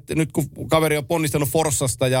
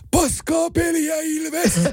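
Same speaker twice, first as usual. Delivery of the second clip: slow, high-pitched mock heckle; imitated fan shout; much slower than usual. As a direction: stretch it out like a lone fan's holler. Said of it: "huutaa kerran".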